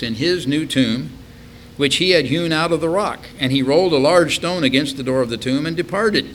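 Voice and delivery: projected Bible verse, rising in pitch; scripture reading. Acted. Preacher reading of Joseph of Arimathea, declaimed. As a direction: read the verse out clearly and firmly.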